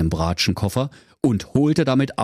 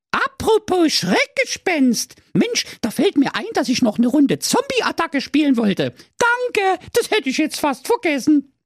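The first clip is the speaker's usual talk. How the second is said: put-on very high, perky girl voice; high-pitched excited princess. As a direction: chirp it like a spoiled little princess. Princess character speaks.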